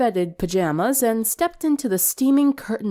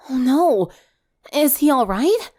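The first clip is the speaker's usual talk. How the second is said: alarmed concern; 5 semitones higher, 4 dB louder than usual. Acted. Alarmed reply to hospital news.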